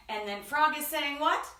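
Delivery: ordinary talk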